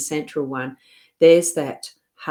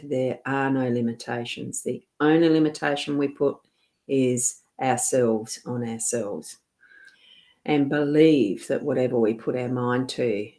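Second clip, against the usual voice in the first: ordinary talk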